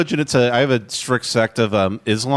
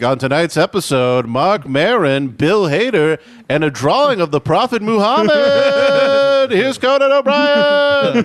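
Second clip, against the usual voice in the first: drawn-out intro climbing much higher; talk-show announcer; 9 semitones higher, slower than usual. Acted. Announcer introducing tonight's guests and host, rising showbiz call. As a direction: stretch each guest name, building to a soaring finish. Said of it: "it's an episode of Conan O'Brien".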